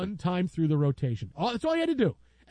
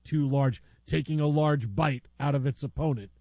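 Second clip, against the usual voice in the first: steady sportscaster call, lower; mock play-by-play; 5 semitones lower than usual. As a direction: call it like a live game broadcast. Mock sports call of hippo fight.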